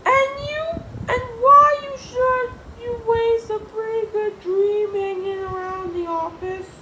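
very high, loud voice; George egging her on. Acted. George urging Miss Anthrope not to waste a dream, high and loud. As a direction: squeal it out as George.

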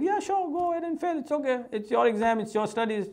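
high, light, easygoing reply; breezy retold permission. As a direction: wave it through like an unbothered parent. Easygoing parent's retold reply to son.

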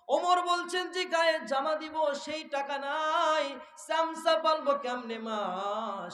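high, slow, drawn-out lament to the crowd; impassioned preaching. Acted. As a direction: chant the plea slowly, starting high and settling. Preaches Omar's plea. (সুর টেনে আবেগী ওয়াজ)

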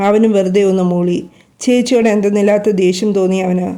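usual speaking voice

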